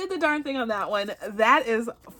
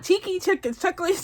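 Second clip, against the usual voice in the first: rushed retry; 8 semitones higher, faster than usual. Shown as a demonstration. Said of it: "read it three times fast".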